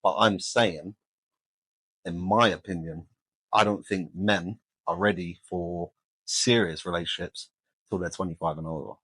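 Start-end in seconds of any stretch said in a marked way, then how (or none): none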